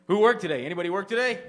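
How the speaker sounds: loud high call to the crowd. Real, not acted. Comic calling out to the audience, loud and high. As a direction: shout it out to the whole room.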